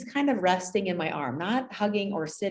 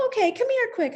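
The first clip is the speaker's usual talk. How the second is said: much higher, brisk call; urgent call; 4 dB louder than usual. Retold or imitated. Teacher calling distracted boy over, high brisk call. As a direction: beckon the child over quickly.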